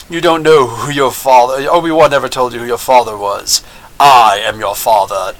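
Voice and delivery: low, weighty villain voice; mock-solemn movie quote, ending deep; movie villain's reveal. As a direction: drop low for a grave, dramatic reveal. Acting out famous movie father reveal.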